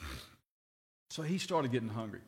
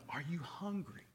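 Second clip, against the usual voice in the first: much softer, intimate question; soft probing question; 9 dB quieter than usual. Acted. Preacher asks the church quietly if they are hungry. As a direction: lean in and ask it gently.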